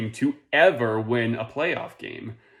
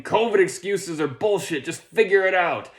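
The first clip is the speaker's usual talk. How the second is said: high, louder mock rant; mock exasperation; 7 semitones higher, 5 dB louder than usual. Comedian paraphrasing the business article, in mock exasperation. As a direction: snap it out high, in put-on impatience.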